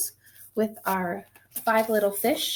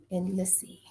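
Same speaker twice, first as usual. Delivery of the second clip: whisper; 5 semitones lower, 7 dB quieter than usual. A narrator's reading. Ending the addition story.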